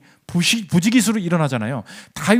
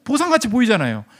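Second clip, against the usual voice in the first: ordinary talk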